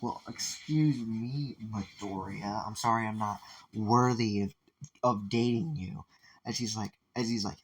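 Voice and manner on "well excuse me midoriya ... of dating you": drip with offended sarcasm; sarcastic Momo. Retold or imitated. Offended Momo cuts in on the boys with slow sarcasm.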